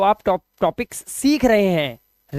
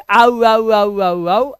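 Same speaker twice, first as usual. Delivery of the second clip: Shakti Kapoor aaoo; much slower than usual. Retold or imitated. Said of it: "आऊ माने शक्ति कपूर".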